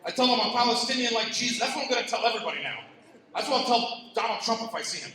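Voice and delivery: ordinary talk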